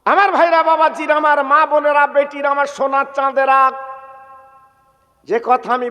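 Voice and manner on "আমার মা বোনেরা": ordinary talk, nothing marked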